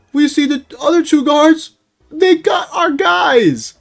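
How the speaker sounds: high, loud squealing yell; mock panic. Storyteller recounting being chased by guards, mock-panicked squeal. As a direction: squeal it out in exaggerated alarm.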